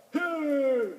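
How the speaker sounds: high, loud rooster doctor; roost-side manner. A narrator's reading. Rooster doctor handing corn to Orley, high bedside manner. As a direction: put on a high-pitched, brisk bird doctor. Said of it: "in his best roost side manner".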